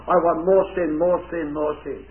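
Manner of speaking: fading sinner's craving. Retold or imitated. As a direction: let the greed die away. Sinner demanding more sin.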